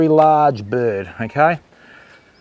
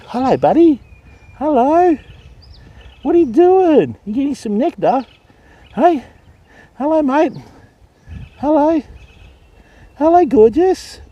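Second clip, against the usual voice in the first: cooing pet talk; 11 semitones higher than usual. Real, not acted. Keeper coos at the cockatoo in a high baby voice.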